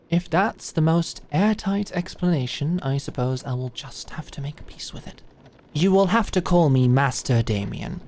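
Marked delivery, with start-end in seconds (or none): 2.8-5.2 whispered grudging aside; grumbled aside. Acted. Conceding the apprentice cover story, whispered grumble. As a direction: mutter it under your breath.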